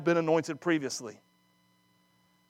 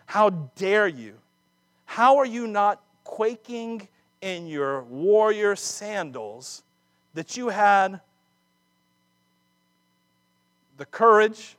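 slow, loud, higher outraged challenge; indignant outrage; 5 semitones higher, 4 dB louder, slower than usual. Acted. Preacher paraphrasing David scolding the Amalekite, slow and outraged. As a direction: drag out the rebuke in disbelief. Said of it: "That's my paraphrase".

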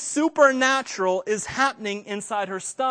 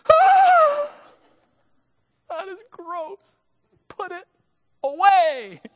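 high yelp, then slow disgusted recoil; grossed-out dad; 13 semitones higher, 8 dB louder, much slower than usual. Retold or imitated. Husband reacting to the baby's hand, high disgusted yelp. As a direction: squeal with disgust, then draw it out. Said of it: "And I went".

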